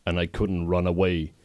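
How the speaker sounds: plain reading voice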